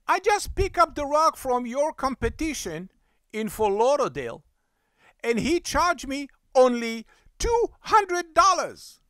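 high, building complaint about the price; indignant protest. Retold or imitated. Customer compares the competition's price, indignant and high-pitched.

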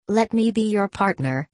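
mildly pleading, pitched a little up; understated plea to be let in; imploring. A narrator's reading. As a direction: blurt it out as an eager appeal. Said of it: "he blurted out imploringly".

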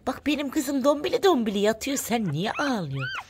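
high cooing mock reproach. Real, not acted. Asking a little one why it cries, high cooing. Talking to crying baby animal.

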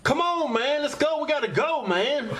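urgent mock yell across a shop floor; calling across the store. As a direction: shout his brother's name to get him out of there. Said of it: "then he screams across the".

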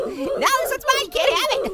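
high-pitched, playful protest; possessive insistence. Pretend. Telling other players it's taken, possessive insistence.

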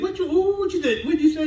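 high, laughing mock wife; imitating his wife. Wife catching him trotting downstairs, high and laughing. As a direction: squeak it up like a surprised wife. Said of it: "She said".

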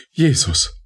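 high, sudden cry of Jesus; terrified cry. A narrator's reading. Woman facing the stiletto cries out in terror. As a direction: cry out expecting the fatal blow. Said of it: "rief sie den Todesstoß erwartend".

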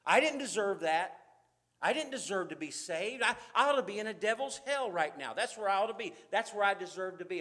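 loud, driving pulpit testimony; full-force preaching. Acted. Preacher confessing to the congregation, loud and fervent. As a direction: hammer home your own unworthiness.

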